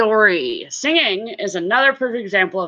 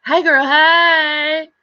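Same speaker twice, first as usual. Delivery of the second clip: high, stretched-out sing-song hail; playful drawn-out high greeting; catchphrase greeting; 8 semitones higher, 4 dB louder, much slower than usual. Retold or imitated. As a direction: act out a gleeful hello, drawing it out slowly. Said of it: "that's what I tell her whenever I see her".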